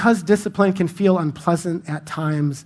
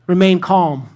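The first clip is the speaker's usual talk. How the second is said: a hammed-up holdup demand, louder; mock bank robber; 3 semitones higher, 6 dB louder than usual. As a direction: play the bank robber, half joking. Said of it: "I said this".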